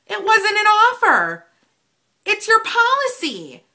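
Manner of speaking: high, loud outburst of frustration; exasperated shout. Real, not acted. Fed-up customer protesting the note on the letter, exasperated shout. Exasperated retort to what was written.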